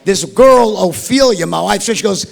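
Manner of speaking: ordinary talk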